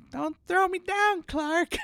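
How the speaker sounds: put-on high-pitched sweet old lady pleading; cute old aunt voice. Imitating Aunt Bethany begging Clark in a high voice. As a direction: raise the voice into a frail granny squeak. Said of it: "he's like".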